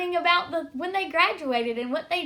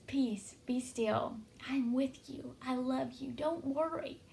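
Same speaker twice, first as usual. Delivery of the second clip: softer and slow, gently reassuring; calm reassurance; 8 dB quieter than usual. Retold or imitated. Pastor voicing God's peace to the anxious, soft and slow. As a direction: soothe a frantic listener, softly. God's calming voice amid worry.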